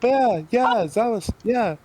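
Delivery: high loud squeals of yeah; squealing excitement. Pretend. Player squeals yes about reading Zamasu. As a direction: squeal it out, thrilled.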